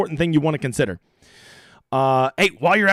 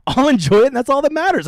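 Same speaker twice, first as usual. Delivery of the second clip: laughing, high and louder; 9 semitones higher, 5 dB louder than usual. Real, not acted. Chuckling to the audience about his own movie joke, laughing. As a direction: laugh through it, pleased with yourself.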